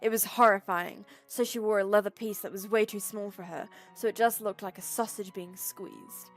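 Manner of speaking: normal talking voice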